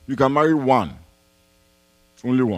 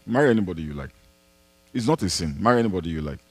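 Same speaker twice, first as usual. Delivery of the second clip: ordinary delivery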